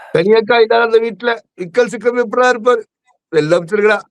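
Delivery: high-pitched tearful wail, hammed up; mock lament. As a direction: wail it like a melodramatic complaint. Wailing mock plea for Kani akka. (போலியாகப் புலம்பி)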